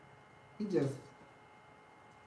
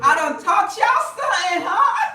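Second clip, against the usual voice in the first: shouting, high and proud; loud boast; 12 semitones higher, 13 dB louder than usual. Real, not acted. Bragging to viewers about her trick, loud high shout. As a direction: crow it to the whole crowd.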